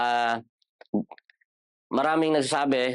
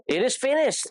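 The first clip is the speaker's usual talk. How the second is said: sudden high cry of It is finished; Jesus' last cry; 12 semitones higher than usual. Retold or imitated. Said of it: "Jesus' last words".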